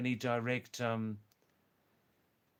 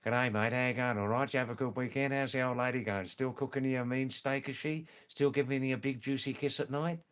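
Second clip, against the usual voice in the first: fast, slurred broad Aussie greeting; broad Australian. Shown as a demonstration. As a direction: rattle it off like a Monday-morning Australian workmate. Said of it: "they talk like this".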